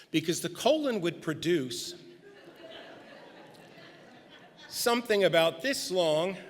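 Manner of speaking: words broken up by real laughter; can't hold back a laugh as you retell it; laughing while quoting. Real, not acted. Pastor cracks up retelling the doctor's colon remark, laughing.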